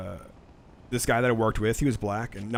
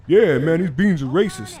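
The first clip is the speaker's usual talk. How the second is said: louder, higher insistent complaint; mock indignant coworker; 5 semitones higher, 7 dB louder than usual. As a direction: push the complaint like he's sure he's right. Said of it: "And he goes".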